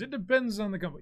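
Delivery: high, loud put-on quote; mock chat commenter. Commentator acting out a viewer's chat message, mock-emphatic.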